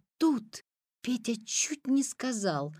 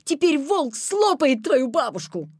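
raised, louder warning; alarmed shouting; 5 semitones higher, 5 dB louder than usual. A narrator's reading. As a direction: call out the danger reproachfully. Petya keeps shouting at the girl.

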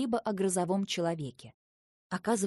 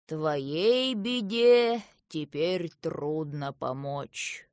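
put-on very slow, lower old gnome voice; drawling old gnome; 4 semitones lower, 3 dB louder, much slower than usual. A narrator's reading. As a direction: draw the words out as an old pipe-smoker. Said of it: "попыхивая трубкой, протянул старый гном".

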